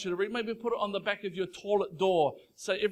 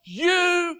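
louder, rising psalm reading building to a shout; full-force preaching; 4 semitones higher, 9 dB louder than usual. Acted. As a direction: proclaim it, building to a cry.

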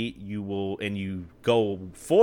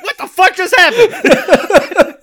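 high, slow, loud disbelief broken by laughter; laughing, drawn-out bewildered outcry; stunned disbelief; 12 dB louder, much slower than usual. Retold or imitated. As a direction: act baffled while cracking up. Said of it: "You're like".

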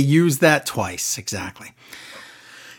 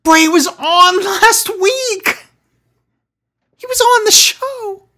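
very high, loud squeal of disbelief; high-pitched exasperated complaint; exasperated outburst; 4 dB louder, slower than usual. Real, not acted. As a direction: protest shrilly, slow and incredulous. Exasperated objection to plot hole.

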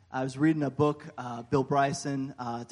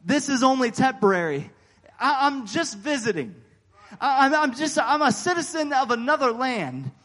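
louder, higher, slow and ringing; preaching at full force; 6 semitones higher, 6 dB louder than usual. Acted. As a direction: preach it to the crowd, drawn out. Preaching climax.

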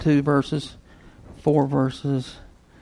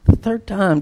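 ordinary delivery

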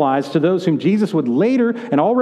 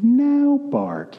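Homer's wise voice; 8 semitones higher than usual. Retold or imitated. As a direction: play a clueless father trying to sound wise. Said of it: "he has a kind of voice that he says".

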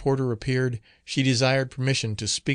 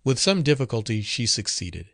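ordinary delivery

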